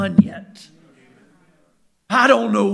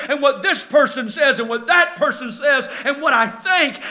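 ordinary talk, nothing marked

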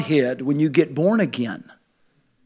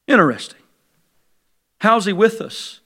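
usual speaking voice

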